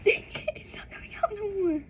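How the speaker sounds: laugh-crying whisper. Real, not acted. Laughing too hard to burp.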